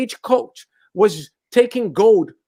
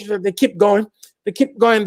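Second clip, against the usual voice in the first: ordinary talk, nothing marked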